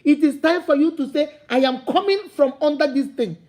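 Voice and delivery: usual speaking voice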